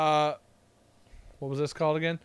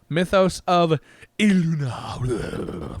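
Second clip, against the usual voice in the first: dramatic reading; much slower than usual. Real, not acted. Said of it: "I had to say it that way".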